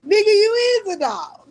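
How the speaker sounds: high, loud laughing jab; laughing shout. Real, not acted. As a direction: yell the insult while laughing.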